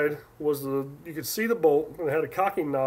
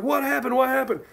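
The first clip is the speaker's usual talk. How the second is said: alarmed; 8 semitones higher than usual. Retold or imitated. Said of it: "I was like".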